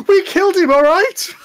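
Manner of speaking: high loud blurt; pipes up. Acted. Brother blurts the confession to the party, high and loud. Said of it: "he pipes up and goes".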